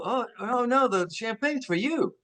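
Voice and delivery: high-pitched, retold correction; lightly mimicked protesting hosts; imitated reassuring executives. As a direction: hasten to explain, voice lifted. Voices executives reassuring him.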